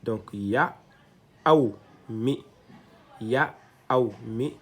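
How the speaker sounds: "ya, aou, mi" said apart and clearly; teacher sounding out a word; syllable drill. Shown as a demonstration. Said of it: "Donc, ça donne un mi".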